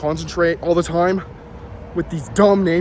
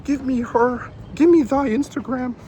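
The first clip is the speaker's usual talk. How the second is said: much higher, whiny put-on begging voice; begging coworker impression; 9 semitones higher, slower than usual. Coworker begging Sawyer for the girl's Instagram, high whiny plea. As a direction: beg like a desperate kid, high and whiny. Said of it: "he looks at me and he goes".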